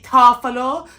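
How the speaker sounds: Tarfalor tried out as an unsure question; unsure pronunciation. Shown as a demonstration. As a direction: sound out Tarfalor haltingly, ending on a rise. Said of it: "how you say it?".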